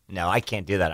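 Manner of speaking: ordinary delivery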